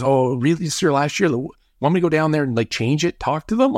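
high, surprised naive coach. Retold or imitated. Retelling the coach's naive offer to players just cut, high surprised voice. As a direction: play the clueless coach offering help.

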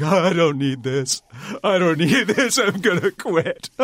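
hammed-up sobbing through the words; mock sobbing. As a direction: imitate a tearful wail, pitch climbing high. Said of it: "I was just guttural sobs".

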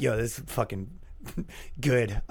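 low, soft impressed chuckle; amused approval. Acted. Podcaster imitating his contented listeners, quiet amused approval. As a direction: play an easygoing fan, quietly tickled.

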